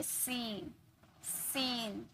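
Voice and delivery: सीन said softly with a long drawn "seeen"; stretched ee. Shown as a demonstration. Teacher models long ee in सीन for students, soft and stretched. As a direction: quietly pull out the ee in सीन. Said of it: "टान कर ले रहे हैं".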